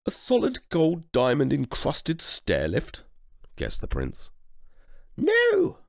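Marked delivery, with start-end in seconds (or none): 0.0-3.0 prince. Acted. Prince guesses a present. 5.2-5.9 high, loud royal snap; sharp high queenly no; snapped no. Acted. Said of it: "snapped the queen".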